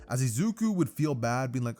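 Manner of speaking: plain reading voice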